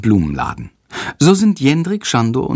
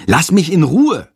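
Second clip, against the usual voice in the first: higher, louder annoyed snap; hissing back; 8 semitones higher, 4 dB louder than usual. Acted. Boy snaps at giggling classmate, annoyed. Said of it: "faucht Jendrik".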